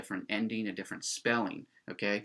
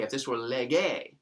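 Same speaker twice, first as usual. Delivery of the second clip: normal talking voice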